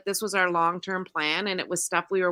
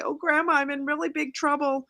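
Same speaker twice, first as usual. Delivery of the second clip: higher, whiny fake-crying teen; a hint of a sobbing grandson; mock crying kid; 6 semitones higher than usual. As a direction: scammer faking tears to grandma. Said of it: "the kid starts to cry".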